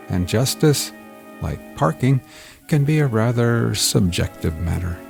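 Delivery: wry, animated storyteller teasing dark events; theatrical host introduction. Acted. Host warns sensitive listeners before the show, theatrical introduction.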